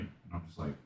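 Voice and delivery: usual speaking voice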